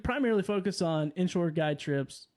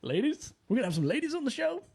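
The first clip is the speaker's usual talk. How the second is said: high, fast eager guy voice; excited guys; 8 semitones higher than usual. Retold or imitated. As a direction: mimic guys getting excited about ladies. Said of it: "like, start going".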